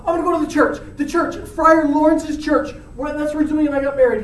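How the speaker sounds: high-pitched, rattled pleading; acted panicked confession. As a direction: spill it to a buddy in a panic. Panicked Romeo confesses to friend.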